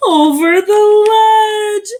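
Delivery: high, loud, slow alarm; high, slow, loud exclamation; dramatic cry. A narrator's reading. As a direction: call out the plunge. Narrator dramatizes the fall.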